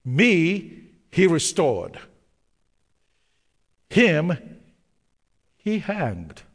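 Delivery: "Me" and "Him" weighted, each phrase slowed and set apart by pauses; slow emphatic reading. Shown as a demonstration. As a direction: lay out "Me he restored. Him he hanged." slowly with pauses after "Me" and "Him". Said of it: "Did you see it?".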